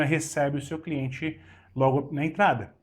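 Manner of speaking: ordinary talk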